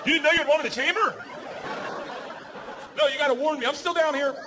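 high-pitched outraged complaint; indignant protest. Acted. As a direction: protest high, like the wronged husband. Acted indignant complaint to wife.

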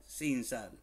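ordinary talk, nothing marked

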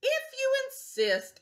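very high, sugary reply; sweetly. Acted. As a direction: answer sweetly, unbothered. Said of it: "answered the friendly ladybug sweetly".

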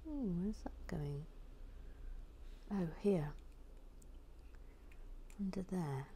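slow hushed whisper while arranging; soft whispered muttering. Real, not acted. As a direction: whisper it under the breath.

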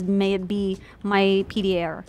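usual speaking voice

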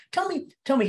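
high, teasing demand; playful, high-pitched coaxing; joking demand. Acted. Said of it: "he's just joking with him".

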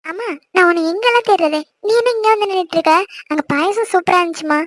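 high, light complaining call to mum; bubbly girl finding her mother. Acted. Daughter calling mother.

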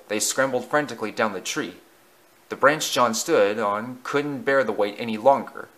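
ordinary delivery

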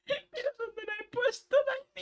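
very high, mock-crying plea; whiny crying. Real, not acted. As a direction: wail to Dani. Can't find bed, whining.

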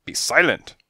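loud, high order to be silent; sharp command. A narrator's reading. Fortune-teller silencing the detective, sharp loud command. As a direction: cut him off sharply. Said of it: "she said".

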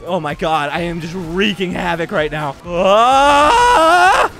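usual speaking voice

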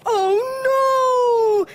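long, high, loud wail; drawn-out high storybook cry; trumpeting elephant. A narrator's reading. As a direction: trumpet your dismay like an elephant. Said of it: "trumpeted Elephant".

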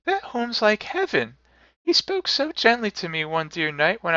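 put-on very high little-girl voice; child brimful of glee. A narrator's reading. Little sister telling her brother good news, high and gleeful. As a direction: chatter joyfully in a small child's pitch. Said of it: "said the child, brimfully of glee".

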